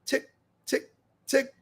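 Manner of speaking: whispered tick tick imitation; ticking sound. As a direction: mimic a watch ticking softly.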